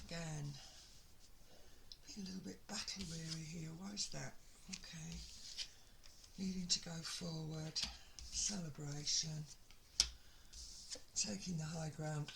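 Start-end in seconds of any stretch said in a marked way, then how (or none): none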